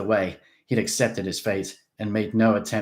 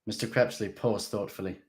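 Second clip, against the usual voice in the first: ordinary delivery, nothing marked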